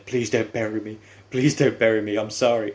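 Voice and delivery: lightly acted, insistent begging; retold pleading. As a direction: act out a beaten wrestler's plea. Retold beaten wrestler begging for mercy.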